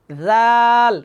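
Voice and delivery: "জাল" as a lisped "dhaal", long vowel; tongue between teeth to start "জাল"; thin pronunciation. Shown as a demonstration. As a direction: chant Arabic "জাল" lightly buzzed and held. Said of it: "একটু পাতলা করে পড়বো".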